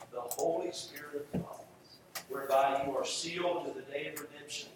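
normal talking voice

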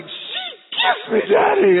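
put-on very high, slow little-boy squeal; mock thrilled child. Cody telling Dad about Minnie, hammed-up thrill.

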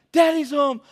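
put-on high, loud panicked toddler voice; terrified child. Three-year-old running from dad, terrified and high. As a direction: act a frightened small child crying out. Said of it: "terrified and running".

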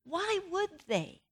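incredulous, hurt protest, rising high; indignant disbelief. Retold or imitated. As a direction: voice her wounded indignation at being hurt. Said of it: "My reaction is".

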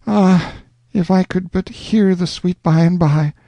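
a yearning sigh of a wish; Alonzo pining. A narrator's reading. Lonely Alonzo pining for his love's song. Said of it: "shed tears of anguish and say".

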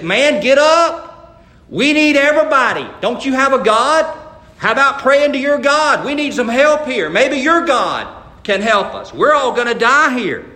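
urgent pleading shout. Retold or imitated. Captain begging Jonah to pray, loud urgent plea. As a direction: rouse a sleeping man as the ship sinks.

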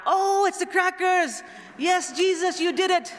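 put-on high, loud, drawn-out childlike cheering; imitated excited kids. Kids run out as the box is opened, imitated excited shouting. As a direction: cheer like kids getting their wish. Children discover the delivered crackers.

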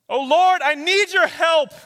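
high, very loud cry to God; crying out for help. Acted. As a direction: cry out desperately in prayer. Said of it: "cry out that prayer of help".

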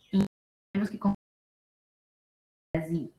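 ordinary talk, nothing marked

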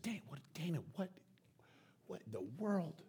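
hushed, halting disbelief; bewildered dad. Retold or imitated. Dad to wife in the car, quietly bewildered. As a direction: stammer it softly, at a loss.

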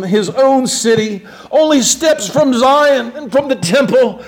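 high, loud preacher's cry building through the list; soaring pulpit fervor; impassioned preaching. Acted. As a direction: preach it at full force, voice climbing. Preaching climax on the crucifixion.

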